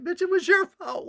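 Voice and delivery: high, loud furious yell at him; angry yell. Retold or imitated. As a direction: blame him, loudly and high. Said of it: "And I'm like".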